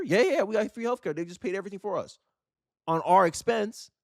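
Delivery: lightly voiced bragger; mock bragging. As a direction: brag like it's nothing. Acted bragging reply.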